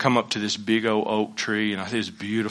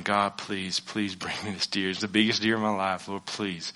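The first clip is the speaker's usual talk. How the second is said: much softer, pleading under the breath; hushed prayer; 3 semitones lower, 10 dB quieter than usual. Retold or imitated. Hunter begging God for the buck, softly pleading. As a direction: beg quietly so the deer won't hear. Praying in the deer stand.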